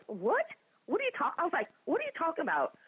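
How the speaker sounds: high, incredulous squeak of confusion; high-pitched what-is-this confusion; retold disbelief. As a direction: play it baffled, voice jumping up. Baffled reaction to Brian's call.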